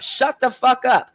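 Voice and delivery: ordinary talk, nothing marked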